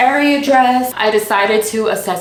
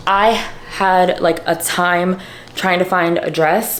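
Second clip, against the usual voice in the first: ordinary talk, nothing marked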